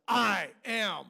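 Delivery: thunderous declaration. Shown as a demonstration. Pastor demoing Jesus's thundering I am. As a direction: proclaim it like peals of thunder. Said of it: "with capital letters and thunder".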